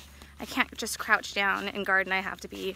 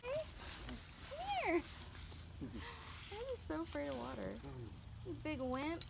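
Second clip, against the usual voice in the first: teasing dog voice; 6 semitones higher, 17 dB quieter, faster than usual. Acted.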